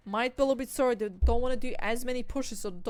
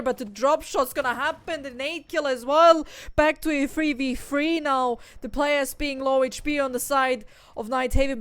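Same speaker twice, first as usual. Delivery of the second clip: live play-by-play; 5 dB louder than usual. Acted. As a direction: call the action like a hyped caster. Caster calls a live fight.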